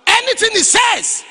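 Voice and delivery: slow, high, very loud cry; preaching shout. Real, not acted. Preacher exhorting the young man to submit, slow shouted cry. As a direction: stretch out Anything and shout it.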